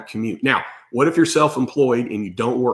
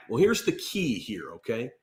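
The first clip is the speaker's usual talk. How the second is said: ordinary delivery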